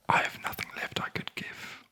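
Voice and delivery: plain reading voice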